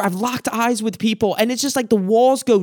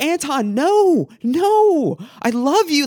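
urgent pleading; 6 semitones higher, slower than usual. Retold or imitated. Pleading with his defensive brother.

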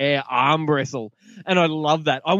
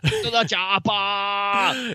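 put-on high, very slow Austrian-accented echo; Austrian-accented catchphrase; 5 semitones higher, much slower than usual. Co-host echoing the classic line back, drawn-out Austrian accent. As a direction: draw out the last word, Austrian-style. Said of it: "ever been pronounced that way".

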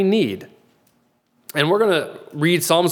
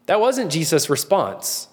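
ordinary delivery

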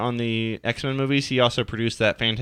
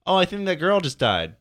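higher-pitched, lightly acted onlooker; idle mock surprise at the screen; bar patron reacting; 6 semitones higher than usual. Bar-goer noticing a movie death, mildly mock-surprised. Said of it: "people were like".

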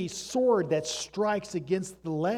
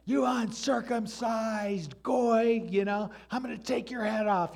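hammed-up fierce challenge; David's retort; 6 semitones higher than usual. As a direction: hurl it back at the giant. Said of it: "and he's like".

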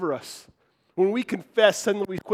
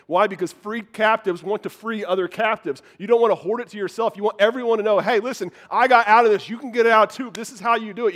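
rising, louder preaching push; preaching at full force; 5 semitones higher, 7 dB louder than usual. Acted. Preacher urging his church to share their freedom, full-force preaching. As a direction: lift the congregation with it.

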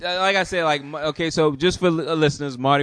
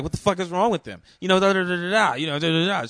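going off; 6 semitones higher, faster than usual. Retold or imitated. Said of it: "She's just going off".

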